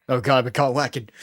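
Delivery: loud, flustered panic; panicked exclaim. Real, not acted. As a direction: blurt it out, caught out. Caught by both friends.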